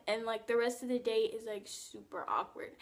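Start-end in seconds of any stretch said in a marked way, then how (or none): none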